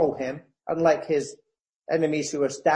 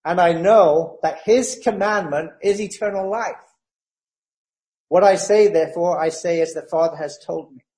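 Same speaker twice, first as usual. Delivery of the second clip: scripture reading; 4 dB louder than usual. Acted. Reads verse fifty aloud.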